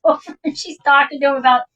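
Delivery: ordinary talk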